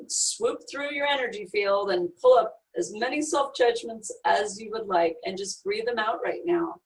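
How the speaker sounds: calm, soft guiding voice, unhurried; guided meditation. Acted. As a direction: lead them gently inward.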